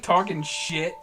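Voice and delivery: ordinary talk, nothing marked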